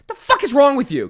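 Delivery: high, loud indignant demand; acted-out angry challenge; retold yell. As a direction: yell it like a reenacted argument. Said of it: "And so I yelled".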